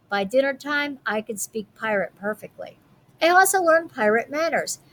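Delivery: usual speaking voice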